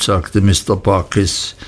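ordinary delivery, nothing marked

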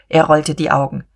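ordinary delivery

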